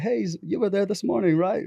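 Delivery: put-on Peter Young's accent. Friend greeting him at the mixer, in a put-on accent. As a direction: imitate the friend in a mock accent. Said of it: "That's my accent".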